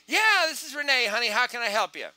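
high, loud, eager answer; upbeat phone prospect. Acted. Trainer plays Renee answering a sales call, upbeat. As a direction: pick up loud and high, bubbling over.

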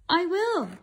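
high, eager little promise; determined baby bird. Acted. Bird vowing to find mother, high determined chick. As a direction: insist like a small brave chick.

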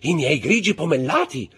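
high, loud outraged exclamation; cry out. A narrator's reading. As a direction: cry out on recognising what is yours. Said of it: "gridò la signora Danglars". (grido acuto)

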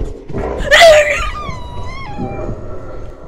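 high, loud shrieking laugh of fright; terrified laughing scream; panicked scream-laugh. Real, not acted. Caught by monster, scream-laugh.

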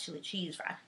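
ordinary talk

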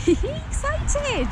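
high laughing exclamation. Real, not acted. Laughing at excited horse.

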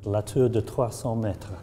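slow, careful English speaker's French accent; halting, modest French accent; terrible French accent. Shown as a demonstration. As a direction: read the French title slowly, in a self-confessed bad French accent. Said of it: "you get to hear my terrible French accent".

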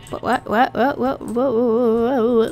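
stuttered whats melting into fake sobs; mock-whimpering disbelief. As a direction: repeat it until it turns into a whimper. Stammering disbelief after losing the cow.

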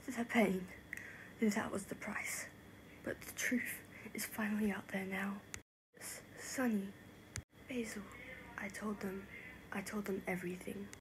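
soft, subdued, near whisper; hushed reflection. Acted. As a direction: say it quietly, spent after confessing. Quiet reflection after confessing.

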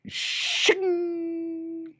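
high metallic shing; knife shing sound. A narrator's reading. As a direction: ring like a drawn blade. Knives drawn.

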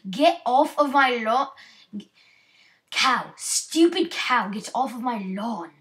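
loud, hammed-up British rant; British accent. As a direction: shout threats at the cow in mock British. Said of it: "I'm going to switch to my British accent".

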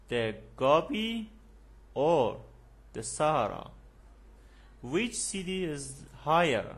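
drilling the rising-falling choice tune for students; choice question intonation. Shown as a demonstration. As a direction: rise on each option, fall on the last, slow. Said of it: "Pay attention to your intonation".

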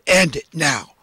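normal talking voice

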